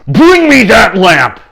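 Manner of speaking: slow, high, shouted command; frenzied order. Acted. King ordering his henchmen out, frenzied shout. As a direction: bellow each word at the henchmen. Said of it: "whipped himself into a huge frenzy".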